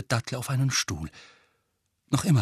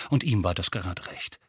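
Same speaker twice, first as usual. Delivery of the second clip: plain reading voice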